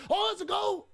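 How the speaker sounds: sudden high cry of joy; excited shout. Real, not acted. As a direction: yell as the goal goes in. Goal scored while watching live.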